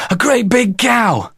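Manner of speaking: put-on high, slow, breathless child; out-of-breath boy's alarm; puffing and panting. A narrator's reading. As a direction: pant it out, stretching the words. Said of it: "with a puff and a pant".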